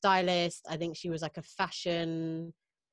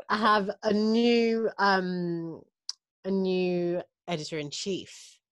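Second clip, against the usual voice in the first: slow build-up; 2 semitones higher, 3 dB louder, slower than usual. Real, not acted. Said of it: "But wait for it".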